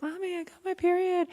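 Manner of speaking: high-pitched, imitating a thrilled girl calling mom; excited child. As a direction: squeal it to Mommy like your younger self. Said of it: "I was so excited".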